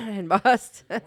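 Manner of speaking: loud echo through laughter; laughing. Real, not acted. Host amused by co-host's Boston joke, laughing. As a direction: repeat it while cracking up.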